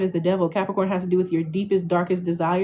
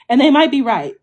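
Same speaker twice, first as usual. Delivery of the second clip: loud, pointed delivery; emphatic; 4 semitones higher, 7 dB louder than usual. Shown as a demonstration. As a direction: hit it hard and loud. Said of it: "repeated for emphasis".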